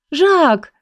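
high, delighted call of a name; light up and call the cat's name; glad exclamation. A narrator's reading. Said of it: "воскликнула я обрадованно".

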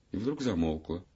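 usual speaking voice

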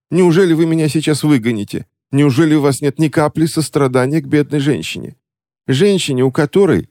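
high, whining mock lament; feigned pitiful plea. A narrator's reading. Witch feigning grief to move the clerk. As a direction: beg for compassion theatrically. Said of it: "жалобно выдохнула Ремора".

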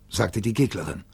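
plain reading voice

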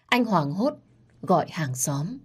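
ordinary delivery

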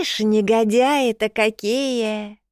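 дребезжащий старческий голос, медленно; ворчливая бабка бранится; grumpy old woman. A narrator's reading. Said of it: "дребезжащий старческий голос". (сварливая старуха, дребезжащим голосом)

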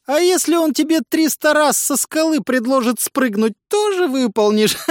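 high, loud, strange taunting voice; mocking voice. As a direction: jeer at him from inside his head. Mocking voice in his head.

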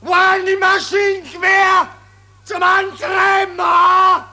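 high, loud, fast barrage of demands; furious shouting. Acted. General screams at his staff about missing guns. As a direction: hammer every question at them.

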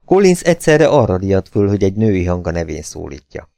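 usual speaking voice

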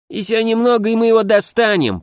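high lisping little voice; a small voice lisping eagerly; slushy lisp. Acted. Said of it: "послышался чей-то шепелявый голосок".

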